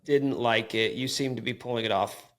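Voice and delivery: ordinary talk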